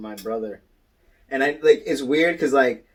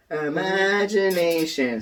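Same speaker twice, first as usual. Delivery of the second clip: high, drawn-out cartoon wonder; slow, high singsong flourish; SpongeBob; 7 semitones higher, slower than usual. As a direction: stretch it out like a dreamy cartoon. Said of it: "like when SpongeBob goes".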